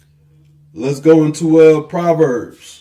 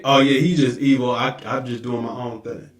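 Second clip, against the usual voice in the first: lower, softer dismissive mumble, imitating; mock excuse; 6 semitones lower, 6 dB quieter than usual. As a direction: shrug it off in a lazy mock voice. Mocking a careless excuse.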